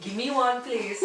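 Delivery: giggling through a begging line; laughing mock plea. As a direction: beg playfully while laughing.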